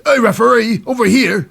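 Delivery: high, loud mock English holler; Charlie Dempsey calling out. Wrestler calling the referee over, hammed-up English accent. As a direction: call the ref over in a bad English accent. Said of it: "Charlie Dempsey says".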